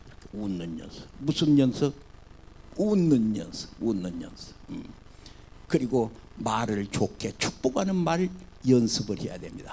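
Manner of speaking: normal talking voice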